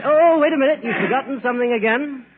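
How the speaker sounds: high, loud call after someone leaving. Acted. Host calling after the departing salesman, loud and high. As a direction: stop him at the door, loud and high.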